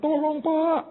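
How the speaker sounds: high, drawn mock plea; imitated crying. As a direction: mimic a caller begging for help. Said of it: "Dia nangis". (menirukan rengekan memohon)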